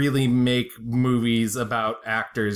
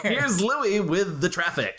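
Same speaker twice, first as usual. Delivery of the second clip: radio host; 6 semitones higher, slower than usual. Pretend. Radio host bit, hands over ears.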